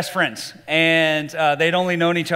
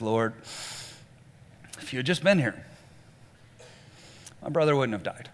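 softer, lower, numb and grieving; numb echo; 5 semitones lower, 9 dB quieter than usual. Retold or imitated. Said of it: "She just kinda echoes her sister".

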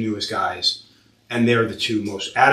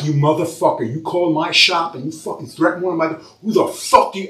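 higher, louder angry outburst; reliving a furious phone rant; furious yelling; 7 semitones higher, 4 dB louder than usual. Retold or imitated. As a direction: blow up at the caller who threatened staff. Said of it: "I literally lost my fucking mind".

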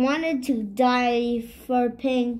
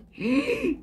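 a sharp high gasp; startled gasp; 6 semitones higher, 4 dB quieter than usual. Acted. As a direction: wake with a start. Character startled awake.

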